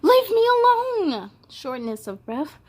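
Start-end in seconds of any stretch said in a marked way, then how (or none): 0.0-1.3 high, loud snapping yell; child yelling to be left alone; irritable shout. Acted. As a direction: shout like a fed-up kid.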